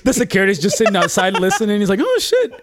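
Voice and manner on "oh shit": mock shocked guard. Voicing the security guard.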